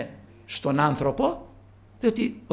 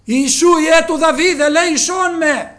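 higher, louder plea to Jesus; crying out for mercy; 14 dB louder than usual. Retold or imitated. Blind Bartimaeus crying to Jesus, loud plea. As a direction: call out like a blind beggar begging. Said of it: "Έκραζε, λέει, δυνατά".

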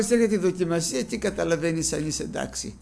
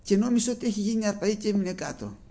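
normal talking voice

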